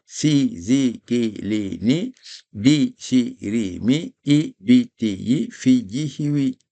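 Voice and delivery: slow drill of si, zi, ki; repeat after me. Shown as a demonstration. Teacher leading a repeat-after-me drill. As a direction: model each syllable for students to echo. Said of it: "répétez moi".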